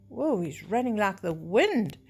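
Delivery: ordinary delivery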